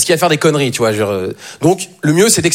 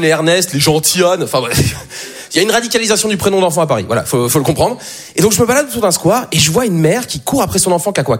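usual speaking voice

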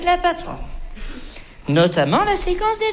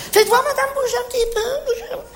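gushing encouragement; 10 semitones higher than usual. Acted. Coaxing customer to move.

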